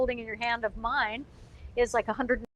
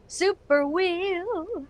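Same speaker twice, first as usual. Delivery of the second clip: slow, stretched, higher hype; drawn-out announcer call; 6 semitones higher, much slower than usual. Acted. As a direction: drag it out like a showman. Launching the super prize wheel.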